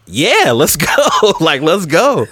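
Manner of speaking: hyped, laughing agreement; excited yes. Retold or imitated. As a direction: retell jumping at the offer, with a laugh. Retells eagerly accepting guest request.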